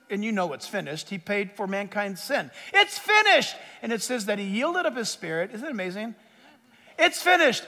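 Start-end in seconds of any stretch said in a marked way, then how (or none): 2.7-3.6 high-pitched proclamation; imitated cry from the cross. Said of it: "cried out". 7.0-7.6 imitated cry, raised in pitch; high proclaiming cry. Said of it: "cried out".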